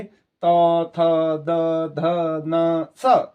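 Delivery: तो, थ, द, ध, न, स drawn out, tongue at the teeth; dental sound drill. Shown as a demonstration. Teacher showing pupils how स follows the dentals, dental drill. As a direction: chant तो थ द ध न evenly, then a crisp dental स. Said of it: "ऐसे ही आपको इसको उच्चारण करना है".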